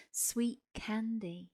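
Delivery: ordinary delivery, nothing marked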